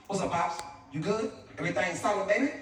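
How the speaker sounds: lightly voiced laid-back neighborhood son; Trey. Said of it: "This is how Trey come in the house and speak".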